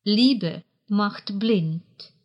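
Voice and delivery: slow, even phrase to memorise; slow dictation. Shown as a demonstration. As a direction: say it slowly for learners to repeat. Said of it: "Запомните немецкую идиому". (медленно и чётко, для запоминания)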